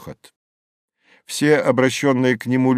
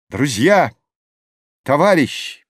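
raised voice opening a speech; loud, not quite firm; 4 semitones higher, 3 dB louder than usual. A narrator's reading. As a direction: address the crowd, a touch unsure. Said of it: "начал Юний громким, но не совсем твёрдым голосом".

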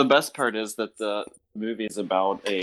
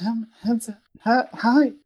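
much higher, breathy-free falsetto practice; practicing a high feminine voice by the trash; 13 semitones higher than usual. Retold or imitated. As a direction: try out a feminine voice shyly. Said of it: "It's like".

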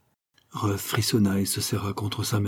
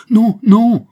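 much higher, loud cry; 14 semitones higher, 13 dB louder than usual. A narrator's reading. Daughter cries out against her mother, high and loud. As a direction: cry out in alarm. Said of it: "s'écria Ruth".

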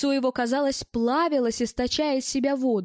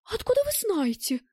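high, startled question; suddenly alarmed, voice jumping up; 11 semitones higher than usual. A narrator's reading. Round-eyed woman, startled by talk of fake dollars, alarmed. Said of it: "всполошилась круглоглазая".

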